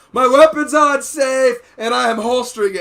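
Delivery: joking shouted announcement. Retold or imitated. Holstering on the pistol range, joking shouted announcement. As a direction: bellow the safety call as a joke. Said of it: "I did that every time I holster my pistol".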